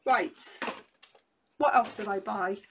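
ordinary talk

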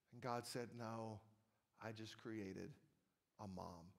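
soft, hushed, heartfelt storytelling; tender quiet reading. A narrator's reading. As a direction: let the ending land gently and quietly.

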